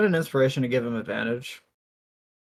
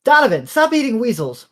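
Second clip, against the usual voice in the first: high, loud exasperated scolding; raised-pitch telling-off; yelling; 9 semitones higher, 6 dB louder than usual. Acted. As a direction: yell a reprimand across the group.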